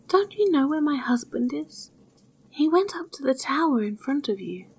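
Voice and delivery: worried wife waking the youth, pressing; anxious wife. A narrator's reading. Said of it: "At last she became anxious".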